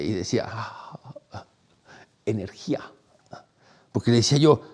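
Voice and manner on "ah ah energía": breathy soft hush; a secret breathed out; whispered mystery. Retold or imitated. An old monk answering a question, hushed whisper.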